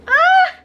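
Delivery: short, high, loud "ah" cry; exasperated scream. Real, not acted. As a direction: let out a sudden high yelp of exasperation. Exasperated cry after retelling Nick.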